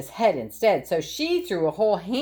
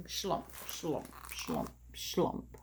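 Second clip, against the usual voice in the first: sad slump sounds; 4 semitones lower, 13 dB quieter, much slower than usual. Acted. Dragging himself home.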